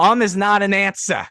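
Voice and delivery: stern Judge Judy, loud and high; Judge Judy impression. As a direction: snap it like a TV judge. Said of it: "I'm a Judge Judy watcher, and I did a sound test by going".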